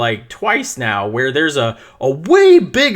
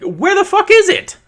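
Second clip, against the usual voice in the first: shouted, pitched way up in disbelief; outraged yell; 3 dB louder than usual. Retold or imitated. Parent at a game yelling about the missing kit. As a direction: yell it out, furious and stunned. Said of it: "I'm like".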